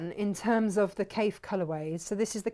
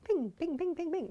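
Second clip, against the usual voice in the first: high sing-song pings; high playful ping; 9 semitones higher than usual. Pretend. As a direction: chirp each ping up high. Sound word for pop colour.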